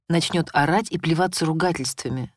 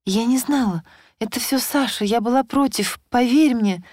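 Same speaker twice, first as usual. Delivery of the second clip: слабо оправдываясь; 7 semitones higher than usual. A narrator's reading. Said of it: "начала слабо оправдываться".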